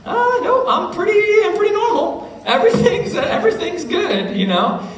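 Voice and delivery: high, sing-song forced cheer with laughs; fake cheerful denial. Mark insists to a friend he's fine, high forced cheer. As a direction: pitch it way up, pretending all is fine. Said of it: "And I'll be like".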